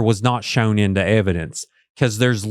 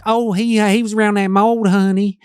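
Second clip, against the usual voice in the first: imitating Natalie; 9 semitones higher than usual. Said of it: "Natalie's like".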